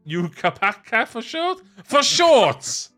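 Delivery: high, rising excited exclaim; delighted. Real, not acted. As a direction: squeal it with glee. Delighted at nickname.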